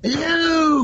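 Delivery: very high falsetto greeting; Mrs. Doubtfire. Retold or imitated. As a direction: trill it out as a mock nanny in falsetto. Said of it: "sound like Missus Doubtfire".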